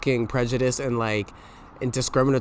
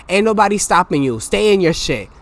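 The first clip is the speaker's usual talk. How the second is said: loud rant; 5 semitones higher, 7 dB louder than usual. Real, not acted. Loud ranting climax.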